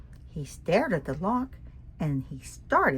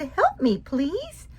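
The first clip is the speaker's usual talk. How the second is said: higher, pleading little starfish; small starfish voice; 10 semitones higher, slower than usual. Acted. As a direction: plead in a tiny high voice. Stuck starfish begs for help.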